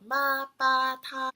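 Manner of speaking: MA, BA, TA each high and level; first tone. Shown as a demonstration. Teacher applying first tone to other syllables, high and level. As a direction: hold MA, BA, TA straight on one high pitch. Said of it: "it works for all the other".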